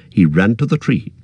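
ordinary talk

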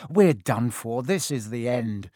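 plain reading voice

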